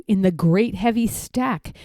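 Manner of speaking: ordinary delivery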